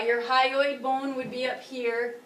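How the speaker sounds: ordinary talk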